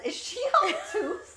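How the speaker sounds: high, slow, laughing delivery; Gideon's insult. Retold or imitated. Fan relishing Gideon's insult, high and laughing, slowly. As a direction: savour the insult while laughing. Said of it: "I love how he says".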